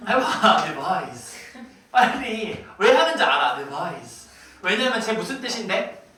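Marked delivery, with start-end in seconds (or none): 0.6-1.4 "Revise" drawn out low, soft and oily; greasy pronunciation. Shown as a demonstration. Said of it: "최대한 느끼하게 읽으셔야 돼요". 3.5-4.3 very low, soft, oily "Revise"; greasy pronunciation. Shown as a demonstration. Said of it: "최대한 느끼하게 읽으셔야 돼요".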